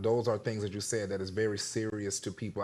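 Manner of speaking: usual speaking voice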